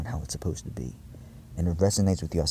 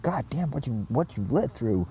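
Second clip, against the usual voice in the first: higher-pitched stunned exclamation; retold amazement; 5 semitones higher, slower than usual. Speaker retelling the layered vision, amazed. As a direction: replay being floored by the sight.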